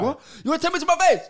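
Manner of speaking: high, loud hammed-up confrontation; loud mocking boxer threat; mock Chisora challenge. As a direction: square up to someone like a brawler.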